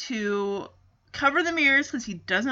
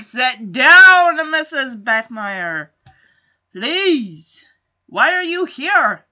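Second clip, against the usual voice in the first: loud, exasperated order; exasperated scolding; 6 dB louder, much slower than usual. Acted. Whole panel tells Missus Beckmeyer off, exasperated. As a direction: scold with a big burst on the first words.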